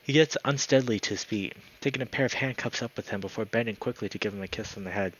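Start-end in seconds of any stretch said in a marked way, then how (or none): none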